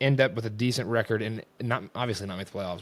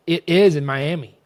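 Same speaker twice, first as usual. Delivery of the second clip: loud, higher imitation of stubborn insistence; insistent contradiction; 6 semitones higher, 9 dB louder than usual. Girl arguing where the game is, mock insistent. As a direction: mimic her insisting, punched hard.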